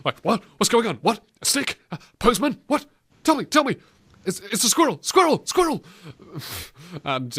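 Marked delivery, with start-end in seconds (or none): 0.2-5.8 high, eager dog voice; short excited bursts like a dog spotting a squirrel; overexcited dog. Acted. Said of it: "It sounds like an overexcited dog".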